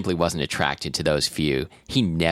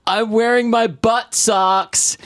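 put-on 1 little boy voice; 4 dB louder, slower than usual. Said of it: "the nine-year-old ... said".

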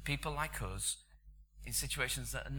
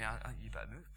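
hushed aside; 3 semitones lower, 6 dB quieter, much faster than usual. Retold or imitated. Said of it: "this guy from Boston looks at me".

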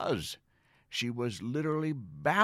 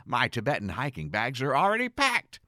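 much higher and louder, thrilled; excited outburst; 8 semitones higher, 5 dB louder than usual. Retold or imitated. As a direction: burst out with delight. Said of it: "I said".

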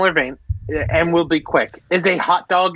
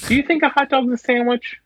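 lightly voiced put-on accent, pitched up; a hint of a silly accent, asking the wife; mock accent; 8 semitones higher than usual. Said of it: "I don't know why I went with an accent".

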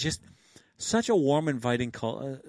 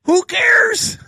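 shouting; 12 dB louder than usual. Retold or imitated. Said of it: "Red walked over".

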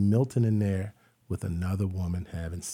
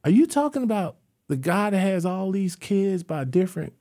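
ordinary talk, nothing marked

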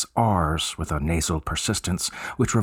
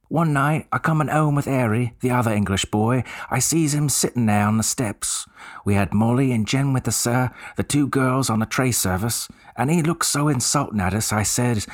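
higher Cockney voice of an eager bellboy; 4 semitones higher than usual. Acted. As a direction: play the chatty Cockney servant, keen to help. Cockney bellboy tells his tale.

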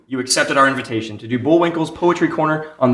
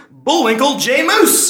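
higher and louder, drawn out slowly; grand announcement; 8 semitones higher, 4 dB louder than usual. Acted. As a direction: announce the star like a ringmaster.

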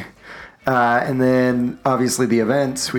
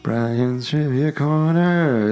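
slow, drawn-out announcer call; 4 semitones higher, much slower than usual. Acted. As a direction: announce it like a game show host. Host announces the segment.

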